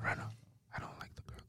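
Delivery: breathy unvoiced whisper. Real, not acted. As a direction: whisper it so only the mic hears. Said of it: "Why are you whispering in the pod?".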